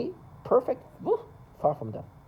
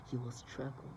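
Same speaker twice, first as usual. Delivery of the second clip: much softer, lowered threat through a door; hushed mock taunt; 4 semitones lower, 14 dB quieter than usual. Picturing himself taunting from the hallway, hushed. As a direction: say it low before slamming the door.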